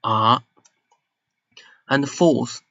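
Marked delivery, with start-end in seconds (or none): 0.0-0.4 third-tone "a" with a falling-rising curve; down-and-back-up tone. Shown as a demonstration. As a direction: let the pitch sink and bounce on "a". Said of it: "go down and then you bounce back".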